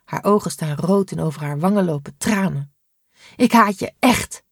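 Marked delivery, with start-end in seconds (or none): 3.4-4.4 tearful, a bit higher and louder, Ik haat je echt with a quiver; trembling voice. Acted. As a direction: still crying, push Ik haat je echt out. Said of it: "met trillende stem".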